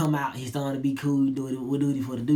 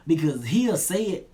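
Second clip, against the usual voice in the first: normal talking voice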